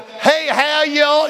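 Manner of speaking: slow, high, mocking fake Southern drawl; fake Southern accent. Preacher mocking transplants who fake a Southern accent, slow and high. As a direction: ham up a newcomer's put-on Southern. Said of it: "not these people that".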